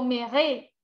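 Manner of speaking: MÈRE wrongly said in two syllables, me-RE, final E sounded; mispronounced silent E. Shown as a demonstration. Teacher showing students the mistake, MÈRE with its mute E sounded. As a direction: say MÈRE with the last E voiced, as "me-re". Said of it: "Yo no digo mère".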